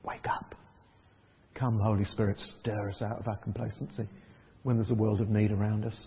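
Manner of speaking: soft, low, earnest prayer; a hushed call to wake up. Retold or imitated. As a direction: breathe it quietly as a plea. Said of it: "Jesus ... says".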